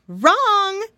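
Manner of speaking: high, loud teacher; ladybug teacher voice. Acted. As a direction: snap it like a strict teacher. Teacher corrects pig.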